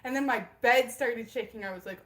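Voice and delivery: ordinary talk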